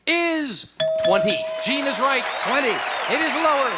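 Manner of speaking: excited game show reveal; game show host. Acted. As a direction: announce the result with flair.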